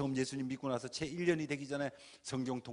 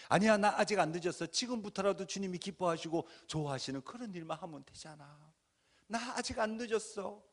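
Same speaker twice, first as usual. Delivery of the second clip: convince yourself with growing hope; hopeful resolve; 6 semitones higher than usual. Retold or imitated. Preacher rallying himself, brightly resolved. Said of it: "제가 저를 합리화하기 시작하죠".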